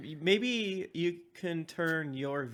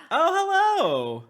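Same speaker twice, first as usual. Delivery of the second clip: high, slow excited hello; delighted greeting; 12 semitones higher, 6 dB louder, slower than usual. Real, not acted. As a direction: greet a friend returning happily. Friend returns to call.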